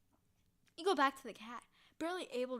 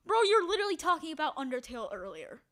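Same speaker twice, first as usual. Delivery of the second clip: ordinary talk, nothing marked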